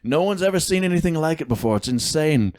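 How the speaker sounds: high-pitched mock presidential boast; Donald Trump. Co-host playing the president boasting of price cuts, mock impression. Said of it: "Yes, Mister President".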